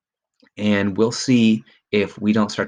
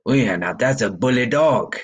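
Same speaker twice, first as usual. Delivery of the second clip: higher-pitched, put-on French accent; 4 semitones higher, 3 dB louder than usual. Shown as a demonstration. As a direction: imitate the narrator's French-accented character. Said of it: "read it in the French accent".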